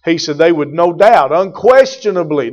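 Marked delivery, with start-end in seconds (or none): 1.3-2.5 loud, driving pulpit preaching; full-force preaching. Acted. Preacher pressing the point on his congregation, loud and driving.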